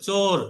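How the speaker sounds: চোর with a round o like chor; চোর, vowel an open rounded o; o sound. Shown as a demonstration. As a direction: say চোর as chor, not choor. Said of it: "ওয়াওটা ও এর মতো হচ্ছে".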